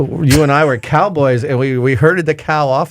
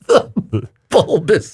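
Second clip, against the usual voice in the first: amused slow echo; 3 semitones higher, much slower than usual. Retold or imitated. Mocking repeat of word.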